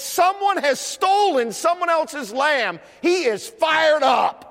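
high-pitched, building sermon delivery; impassioned pulpit storytelling; forceful preaching. Real, not acted. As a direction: build to a stretched-out shout.

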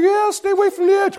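high-pitched mock wife; nervous bride voice. Bride warning husband near the cliff, imitated high and nervous. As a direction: put on a high, wary wifely voice.

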